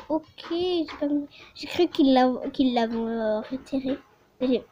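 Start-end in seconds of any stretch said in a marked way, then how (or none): none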